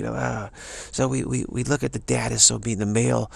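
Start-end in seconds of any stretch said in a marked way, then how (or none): none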